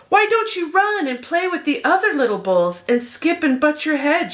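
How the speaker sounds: a hint of a worried mother; mother's voice. A narrator's reading. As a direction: gently urge him to go play. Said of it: "she would say".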